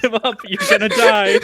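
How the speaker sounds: loud, high-pitched play-acted warning; mock yelling. Co-host joining in as Jackie warns Goku, mock yelling. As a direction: yell it half-laughing, climbing high.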